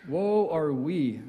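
"Woe are we" drawn out very slowly, stretching "Woe". Retold or imitated. Preacher mimicking the complaint, slow and mournful. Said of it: "here's one that I think I hear".

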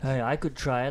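usual speaking voice

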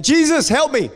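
high, loud pleading cry for help; desperate calling out. Acted. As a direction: call out like blind beggars straining to be heard. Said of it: "they start calling out".

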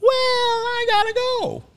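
very high, slow sing-out; drawn-out excuse. Retold or imitated. Uneasy guest trying to escape the gossip, high and drawn out. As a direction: stretch each word in a high mock-casual voice.

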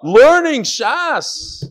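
high, loud, long-drawn words; emphatic amazement. Real, not acted. Reader contrasts Shas with buying jet skis, emphatic amazement. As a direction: proclaim it loudly, stretching each word. Contrast with frivolous crisis purchases.

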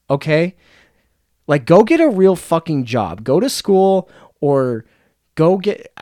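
yelling about scammers and pyramid schemes; heated tirade, voice pushed high and loud; furious rant. Real, not acted. Ranting about solicitors.

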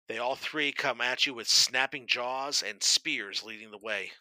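normal talking voice

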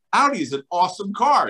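usual speaking voice

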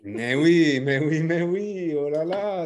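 hammed-up French. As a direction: lay the French on thick, stretched and playful. Hams up French after sexy-accent remark.

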